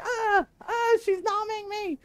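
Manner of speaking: high squealing cry of alarm; mock panic. Husband squealing as wife nibbles his shoulder, high mock panic. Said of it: "and he's like".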